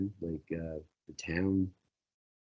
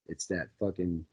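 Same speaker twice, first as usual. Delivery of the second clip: ordinary talk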